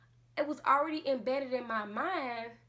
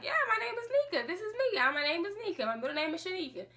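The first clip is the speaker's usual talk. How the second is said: confident self; 8 semitones higher, faster than usual. Retold or imitated. Young confident self quoted.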